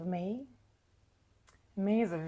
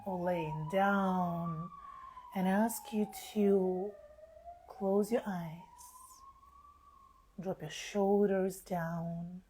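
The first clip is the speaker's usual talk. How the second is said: slow, spacious meditation voice with long pauses; guided meditation; slower than usual. Acted. Healer easing live listeners into relaxation under the full moon, slow guided meditation. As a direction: guide them gently into relaxation, unhurried.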